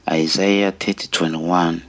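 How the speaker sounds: usual speaking voice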